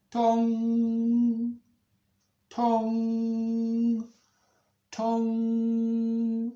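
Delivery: high, loud ringing chime; long sustained bell tones, evenly spaced; town clock. Acted. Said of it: "of the town clock".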